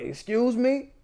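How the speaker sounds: a stunned, offended "Excuse me?" at the insult; incredulous excuse me. Retold or imitated. Hotel clerk reacting to the caller's slur, mock disbelief. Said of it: "Like that in response".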